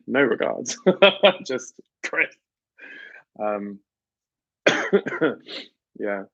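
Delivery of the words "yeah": usual speaking voice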